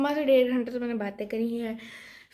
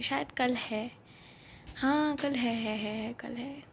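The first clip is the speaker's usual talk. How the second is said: ordinary talk, nothing marked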